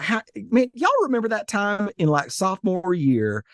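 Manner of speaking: put-on slipping back into a hometown Southern accent; Southern accent kicks in. Greeting old classmates, Alabama accent turning on. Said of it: "I get there and it just turns on".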